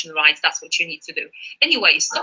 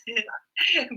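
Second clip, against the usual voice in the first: light laugh; 5 semitones higher, 6 dB quieter than usual. Real, not acted. Laughs after greetings.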